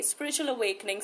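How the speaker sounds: ordinary talk, nothing marked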